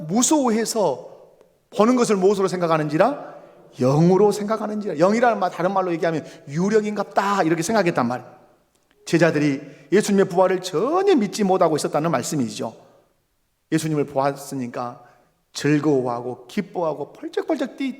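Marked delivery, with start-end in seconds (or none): none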